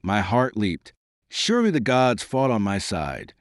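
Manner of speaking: plain reading voice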